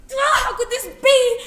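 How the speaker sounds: loud, high, laughing wail of disbelief; hysterical outcry. Acted. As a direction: wail it like a tragedy. Panic over the cockroach.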